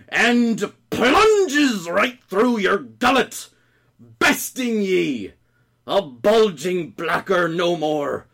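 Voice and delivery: slow, stretched-out theatrical roar; furious. Acted. Heartbroken cook cursing his mate to death, furious. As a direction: drive each word home like a trident blow. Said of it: "Heartbroken and furious".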